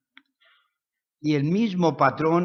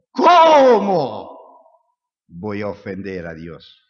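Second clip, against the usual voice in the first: outraged refusal; 4 semitones lower, 8 dB louder, slower than usual. Acted. José to the tempting woman, shocked loud refusal. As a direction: cry out "¿Cómo" in shock, then drop.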